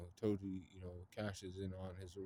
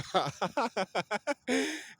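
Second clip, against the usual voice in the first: laugh; 7 dB louder than usual. Real, not acted. Laughing at own retelling.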